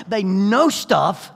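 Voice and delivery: comic roar of the obvious; loud drawn-out punchline. Acted. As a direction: belt it out for the laugh. Punchline to teen audience.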